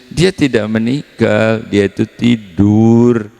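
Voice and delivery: Jesus speaking. Retold or imitated. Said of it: "kata Yesus".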